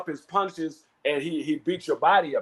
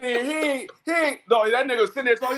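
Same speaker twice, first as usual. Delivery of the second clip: ordinary delivery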